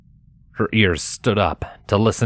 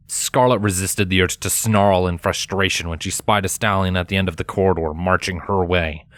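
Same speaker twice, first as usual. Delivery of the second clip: ordinary talk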